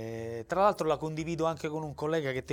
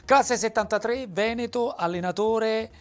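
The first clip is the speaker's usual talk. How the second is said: louder and higher, punchy presentation; announcer intro; 6 semitones higher, 7 dB louder than usual. Real, not acted. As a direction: announce the guest like a show host. Host presents guest like announcer. (presentazione da conduttore, più alta e più forte)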